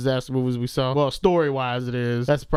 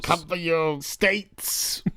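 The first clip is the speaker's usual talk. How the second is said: dark lord; 2 semitones higher, much slower than usual. Pretend. Mocking the villain line.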